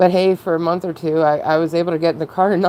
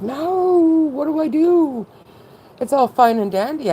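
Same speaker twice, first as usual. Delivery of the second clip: high, louder panicked cry; imitating her own alarm at the doctor leaving; mock panic; 12 semitones higher, 3 dB louder, slower than usual. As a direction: squeal it like the floor just dropped.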